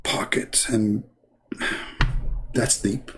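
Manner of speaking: breathy whisper of pocket; whispered mock pocket. Mocking a critic concentrating, whispering pocket. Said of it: "Look how deep he is".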